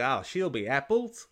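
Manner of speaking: lightly mocking a bad actor's Australian accent; a hint of a put-on Australian accent; understated Australian accent parody; fake Australian accent. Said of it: "when actors do an Australian accent".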